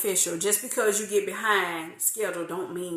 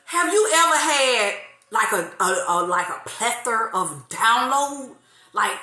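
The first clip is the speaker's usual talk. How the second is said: ordinary delivery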